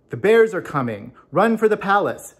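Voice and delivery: loud, urgent shout of alarm; shouted warning. Acted. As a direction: yell it as a warning to run. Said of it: "shouted a strange voice".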